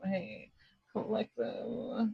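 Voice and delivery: tiny, high, very soft voice; feeble mock calling. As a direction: call faintly as if trapped behind a screen. Acting someone reaching through the screen.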